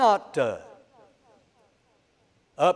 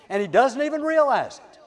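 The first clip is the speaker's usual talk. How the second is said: ordinary talk, nothing marked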